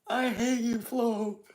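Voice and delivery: usual speaking voice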